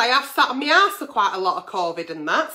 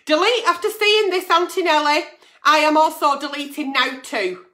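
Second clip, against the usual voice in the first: ordinary talk, nothing marked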